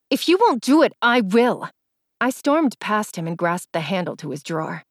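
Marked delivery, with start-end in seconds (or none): 0.1-1.7 high, loud defiant threat; angry. A narrator's reading. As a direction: fire it back, loud and high. Heated quarrel.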